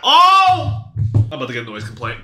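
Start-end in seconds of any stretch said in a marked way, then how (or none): 0.0-0.9 high, loud drawn-out "oh!"; sudden excited yell; startled shout. Real, not acted. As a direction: cry out at a big play. Reacting to a big play.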